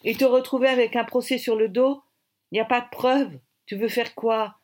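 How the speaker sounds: loud, heated warning; heated argument. A narrator's reading. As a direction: argue back hard, pushing the voice up. Acted heated threat in a dispute.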